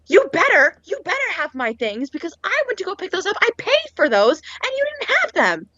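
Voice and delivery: high-pitched mock angry rant; screaming customer. Customer demanding her paid-for things, mock screaming. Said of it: "now she's screaming at me".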